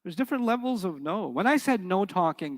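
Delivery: usual speaking voice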